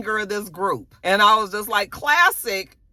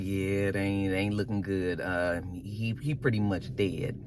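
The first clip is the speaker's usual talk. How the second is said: lower, softer put-on voice; Creepy Daddy; 10 semitones lower, 8 dB quieter than usual. Creepy Daddy breaking bad news, low and soft. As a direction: drop it low for the creepy dad. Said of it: "Creepy Daddy was just like".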